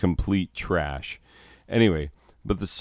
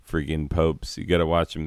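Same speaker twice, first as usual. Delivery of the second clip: usual speaking voice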